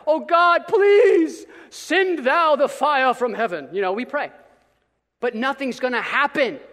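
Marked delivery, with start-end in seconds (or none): 0.1-3.7 loud, slow hammed-up plea to heaven; mock fervent prayer. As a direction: overdo a dramatic prayer. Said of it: "we pray about it".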